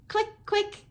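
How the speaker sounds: two sharp clicks with the teeth. Shown as a demonstration. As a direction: click it out like a clave. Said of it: "click my teeth".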